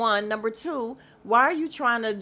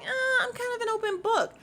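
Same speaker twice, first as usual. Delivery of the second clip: mimicking; 13 semitones higher than usual. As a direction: imitate her in a high, airy pitch. Mimics Angela's reply.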